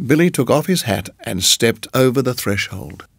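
ordinary delivery, nothing marked

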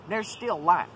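normal talking voice

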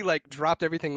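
ordinary talk

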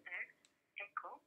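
cell phone line breaking up; tinny phone call audio; over the phone. Real, not acted. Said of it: "you're breaking up".

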